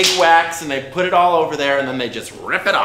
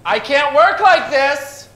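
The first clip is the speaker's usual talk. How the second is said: frustrated outburst; 9 semitones higher, 4 dB louder than usual. Acted. Photographer frustrated by interruptions.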